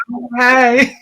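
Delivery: high, nervous fake-friendly hello; high, timid, fake-cheery hi; mock nervous greeting. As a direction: squeak out a scared friendly hi. Nervous greeting to scary passenger.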